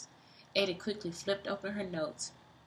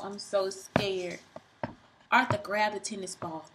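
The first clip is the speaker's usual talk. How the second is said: ordinary delivery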